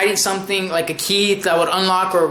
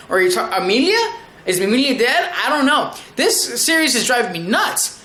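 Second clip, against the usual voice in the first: ordinary delivery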